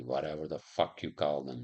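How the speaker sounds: ordinary delivery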